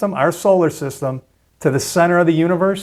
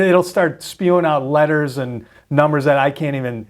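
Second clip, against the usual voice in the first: ordinary talk, nothing marked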